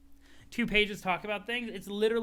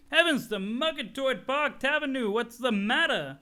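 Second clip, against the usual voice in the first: Jake English voice; 7 semitones higher, 4 dB louder than usual. Acted. Jake worried at sneezing friend.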